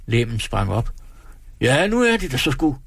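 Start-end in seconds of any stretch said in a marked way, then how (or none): 1.6-2.8 mild mock shout. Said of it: "skreg Brasen".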